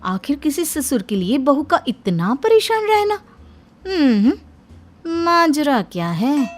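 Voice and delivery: high, loud, probing; suspicious sister-in-law. Acted. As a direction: sniff out a secret, drawing out the last question.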